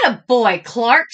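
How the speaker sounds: loud, cheerful praise; praising teacher. A narrator's reading. Teacher praising Clark, loud and cheerful. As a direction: praise him warmly. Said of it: "said Missus Inky Dink".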